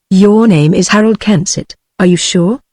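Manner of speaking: plain reading voice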